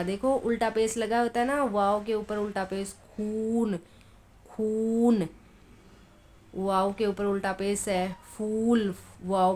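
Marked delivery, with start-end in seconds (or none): 3.1-5.3 खून with a long, held 'oo'; long drawn vowel. Shown as a demonstration. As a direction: stretch the vowel in खून each time. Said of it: "इसको खूब खींच कर पढ़ा जाता है". (खून का ऊ खूब खींचकर)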